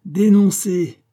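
high, loud ironic taunt; acted mocking challenge; sinisterly ironic. A narrator's reading. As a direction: dare him with menacing irony. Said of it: "rire d'une façon sinistrement ironique".

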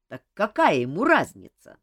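ordinary delivery

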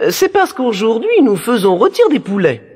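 high-pitched princess replying. Acted. Princess answers first brother in story. (voix aiguë de princesse)

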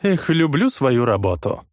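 pleased cocky voice; smug boss. Acted. Noe pleased after slap. (довольный главарь)

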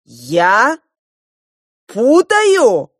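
loud, high indignant question; outraged, near-shouted retort; angry disbelief. A narrator's reading. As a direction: explode on the second word, pitch leaping up. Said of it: "рассердился старший принц".

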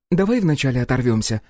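plain reading voice

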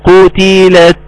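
slow, measured tajweed chant; Quran recitation. Shown as a demonstration. Teacher reciting verse after verse for students, slow recitation. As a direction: hold the long vowels and nasal noon.

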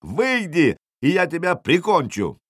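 high-pitched enraged challenge; yelled threat. A narrator's reading. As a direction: call the enemy out to fight. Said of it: "завопил Ярл".

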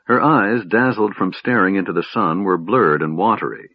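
plain reading voice

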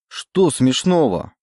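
high, drawn-out indignant question. A narrator's reading. Oleg to the laughing stranger, indignant. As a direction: take offence, pitching it up and stretching it. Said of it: "возмутился Олег".

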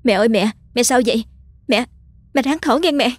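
high, frightened pleading; panicked. A narrator's reading. Daughter panicking over her gasping mother, high frightened plea. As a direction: cry out in alarm to a failing mother. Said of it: "hốt hoảng".

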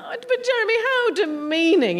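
high, slow mock-shocked appeal; mock outrage. Host teasing Jeremy with mock outrage, high and slow. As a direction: play up the indignation, drawn out.

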